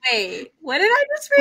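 high, disbelieving retold question; mock shock. Reader recalling a shocking chapter, stunned. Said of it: "I was like".

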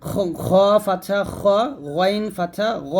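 খ thick and rasped in the throat; গইন and গ said full, with rounded vowel; heavy throat letters. Shown as a demonstration. Teacher modelling the letters that must be thick, খ and গ heavy. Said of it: "এই হরফগুলো অবশ্যই মোটা করতে হবে".

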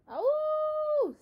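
long high howl, loud; dog howl. Acted. Dog howling over the preacher's sermon, long high howl. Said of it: "said Winn-Dixie".

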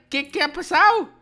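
ordinary delivery

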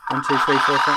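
loud count with feedback howl; audio feedback. Real, not acted. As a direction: count into a looping speaker. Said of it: "it's feeding back and looping back".

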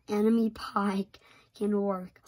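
ordinary delivery

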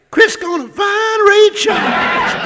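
put-on high, slow, drunken shout; tipsy Isabella. Isabella tipsy on the pier yelling to Chris, in a high drunken holler. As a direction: holler it like a tipsy queen seeing him off.

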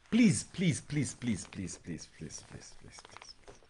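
pleas fading from full voice to a whisper; preacher begging for attention, fading to a whisper. Acted. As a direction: let each please die away to nothing. Pleading fade to whisper.

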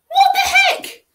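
very high loud disbelieving yelp; high laughing what the heck; startled laughing shout. Acted. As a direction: shriek in amazed disbelief. Child startled by clocks.

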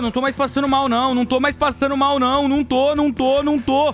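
loud relieved screaming after a big win; excited shouting. Real, not acted. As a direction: yell it out, overjoyed and shaking.

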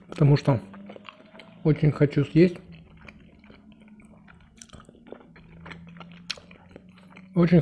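talking between bites; chewing while talking. Real, not acted. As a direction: mouth partly full of food. Seen 1: Continuous eating; dumpling forked into mouth at 2.9, chewing after.

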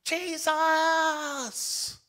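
long, high, drawn-out holler; screaming at full lungs. Acted. Healed man crying out to Jesus, screaming at the top of his lungs. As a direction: belt the name out in a sustained yell. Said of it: "screaming at the top of his lungs".

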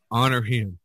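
usual speaking voice

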